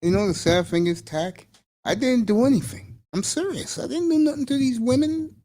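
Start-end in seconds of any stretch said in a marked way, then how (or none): 1.8-5.3 high, pleading mock-innocent protest. To Tack, swearing he did nothing to the ladies, mock pleading. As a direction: plead your innocence in a raised, whiny pitch.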